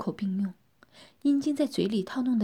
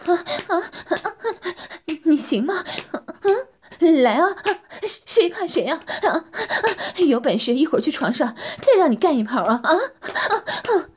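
high, louder panting dare; panting challenge; 7 semitones higher, 7 dB louder than usual. Acted. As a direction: pant out a cheeky dare. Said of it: "喘着气说". (喘着气挑衅)

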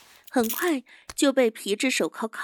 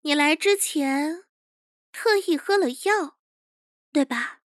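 higher, deliberate accusing question; higher young chief's voice, sure of himself; chief exposing a secret; 4 semitones higher than usual. Acted. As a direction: confront her knowingly, phrase by phrase.

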